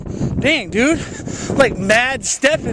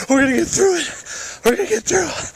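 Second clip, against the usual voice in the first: high, rallying cry of we're gonna get through it; rally the viewers through the storm, tongue in cheek; mock heroic rally; 9 semitones higher, faster than usual. Mock heroic storm pep talk.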